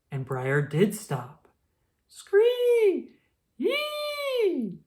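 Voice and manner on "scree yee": very high drawn-out bird screech; hawk screech. A narrator's reading. The red-tailed hawk screeches overhead, very high and drawn out.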